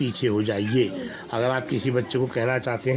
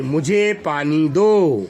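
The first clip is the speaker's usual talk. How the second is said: slow and a bit louder for the class; slow model reading; 5 dB louder, much slower than usual. Shown as a demonstration. Teacher gives sentence for class to echo. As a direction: say it clearly for the children to repeat. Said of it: "teacher models for children".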